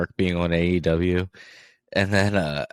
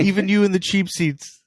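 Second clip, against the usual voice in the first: mock crowd-pleasing shout; 11 semitones higher, 4 dB louder than usual. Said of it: "he's like".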